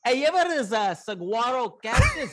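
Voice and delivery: a bad Boston impression of Mark Wahlberg; Boston accent. Guest jokes about the saguaro cactus, in a hammy Boston accent. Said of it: "that's a horrible Boston accent".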